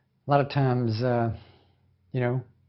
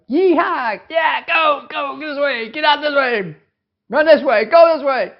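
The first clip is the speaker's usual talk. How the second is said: much higher, loud yeehaw cowboy holler; cattle driver; 10 semitones higher, 5 dB louder than usual. Retold or imitated. Preacher mocking a cattle herder driving the herd, hollering. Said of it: "like a cattle herd".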